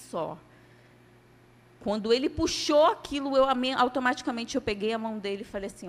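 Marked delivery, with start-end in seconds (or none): none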